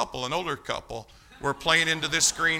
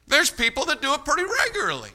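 much higher, emphatic preacher's repeat; insistent, lifted high all through; high-pitched insistence; 10 semitones higher than usual. Real, not acted. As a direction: drive the point home in a raised pitch. Repeats line to drive it home.

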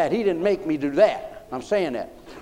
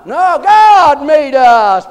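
much louder and higher, slowly stretched; preaching shout; 9 semitones higher, 13 dB louder, much slower than usual. Real, not acted. Preacher shouting to the congregation, slow and loud. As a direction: proclaim it to the back row.

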